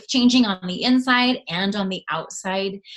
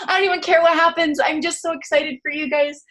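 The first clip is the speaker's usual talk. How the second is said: much higher, louder, fast gush; gushing excitement; 9 semitones higher, 5 dB louder than usual. Retold or imitated. Leader gushing to her team, high, loud and fast. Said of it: "I'm like".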